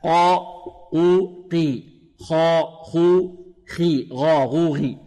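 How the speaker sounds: isolated long syllables, قا قو قي then خا خو خي; graded heaviness drill. Shown as a demonstration. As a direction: slowly step from heavy قا through قو to lighter قي. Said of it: "هكذا".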